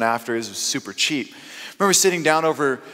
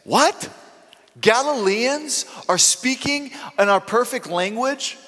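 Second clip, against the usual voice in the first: high, astonished disbelief; stunned crowd; 10 semitones higher, slower than usual. Retold or imitated. Crowd at Pentecost reacts in high amazement. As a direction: gasp it out like you can't believe your ears. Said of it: "the people in the crowd stepped back and were saying".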